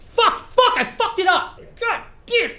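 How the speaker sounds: high, loud frustrated shouts; frustrated yelling. Real, not acted. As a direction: explode at the flubbed line. Flubbed line, swearing outburst.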